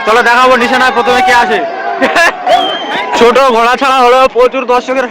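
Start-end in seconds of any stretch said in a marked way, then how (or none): 1.6-3.1 breathy laugh. Real, not acted. Laugh after the start.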